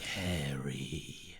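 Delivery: low, hushed, drawn-out eerie call; imitating a creepy whispered summons; whispery tone. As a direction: breathe the name out slowly and softly. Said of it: "in that kind of whispery tone".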